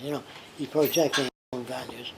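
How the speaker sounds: usual speaking voice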